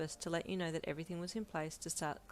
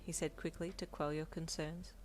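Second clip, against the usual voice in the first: ordinary delivery, nothing marked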